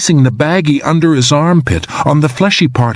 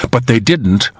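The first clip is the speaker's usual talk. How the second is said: ordinary delivery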